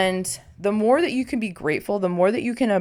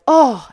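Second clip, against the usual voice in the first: a louder, higher yelp at a stubbed toe; mock pained oh; 5 semitones higher, 8 dB louder than usual. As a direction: act the sudden sting of pain. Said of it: "and it's like".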